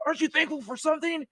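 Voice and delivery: high, fast prodding question; hammed-up sergeant major; mock interrogation. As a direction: needle him playfully. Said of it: "he's like".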